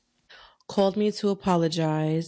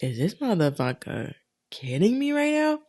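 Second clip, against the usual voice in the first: pitched-up, disbelieving outrage, retold; incredulous disbelief; 4 semitones higher than usual. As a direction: replay the moment you couldn't believe he blocked you. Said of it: "And I was like".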